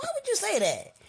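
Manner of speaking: very high, wounded protest; offended disbelief. Retold or imitated. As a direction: squeak out an affronted question. Her reply to the insult, acted.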